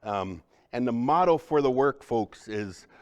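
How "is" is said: normal talking voice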